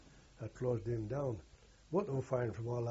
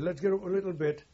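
retold order; 6 semitones higher, 6 dB louder than usual. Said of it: "I said".

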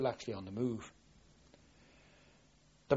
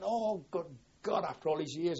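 surprised exclamation; 6 semitones higher than usual. Retold or imitated. Old friend surprised at reunion.

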